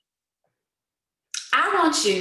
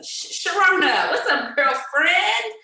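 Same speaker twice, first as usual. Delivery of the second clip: excited greeting; 8 semitones higher, 4 dB louder than usual. Real, not acted. Calling next member on call.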